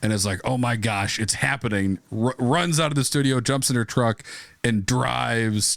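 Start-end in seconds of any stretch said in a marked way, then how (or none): none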